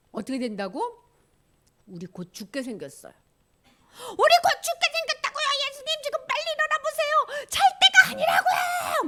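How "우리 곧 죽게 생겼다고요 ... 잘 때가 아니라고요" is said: very high, frantic angry yelling; angry shouting. Acted. Disciples waking Jesus in the storm, high furious shout. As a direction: yell at a sleeper to get up, pitched way up. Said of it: "막 소리를 지르고 예수님한테 막 화를 내고".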